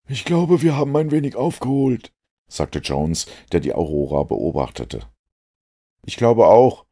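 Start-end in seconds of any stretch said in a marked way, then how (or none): 0.1-2.1 much higher, worried inspector; Jones's voice. A narrator's reading. Jones doubts they can catch the boat, much higher. 6.0-6.9 higher, much louder eager reply; eager Watson. A narrator's reading. Watson agrees with Jones, higher and louder.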